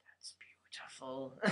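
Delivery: awed whisper. Real, not acted. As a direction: breathe it out in awe. Awed whisper about move.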